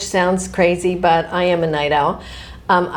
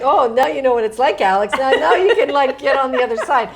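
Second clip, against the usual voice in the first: teasing laugh; 5 semitones higher than usual. Retold or imitated.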